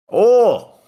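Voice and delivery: very high loud squeal of oh; high squeaky oh. Shown as a demonstration. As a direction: squeal it like a cat. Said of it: "Can you say, "Oh"?".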